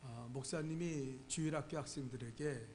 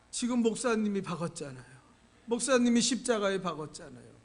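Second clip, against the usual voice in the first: put-on louder, a crying child blurting it out; tearful loud accusation; 4 semitones higher, 11 dB louder than usual. Sobbing child accusing the pastor of nailing. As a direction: shout it through tears, pointing at him.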